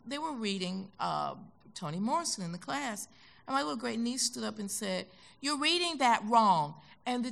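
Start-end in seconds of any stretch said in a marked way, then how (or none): 5.4-6.7 niece's bold objection. Retold or imitated.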